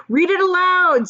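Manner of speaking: high, loud, eager call; excited urging. Acted. Grandpa Joe urges the father to read, excited. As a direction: push him to read it now.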